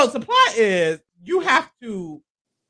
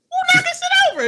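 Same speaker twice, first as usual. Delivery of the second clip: much higher shrieking question; host squeals at the knocked-over sign, much higher; outraged outburst. Real, not acted. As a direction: demand an answer, shocked. Mock outrage at clue box.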